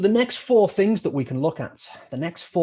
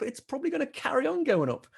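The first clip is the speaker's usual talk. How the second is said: usual speaking voice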